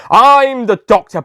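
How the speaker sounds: proud announcement. Acted. Cheerful self-introduction to Sally.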